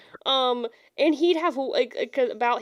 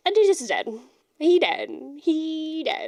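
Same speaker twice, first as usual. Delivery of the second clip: pessimistic son; 7 semitones higher than usual.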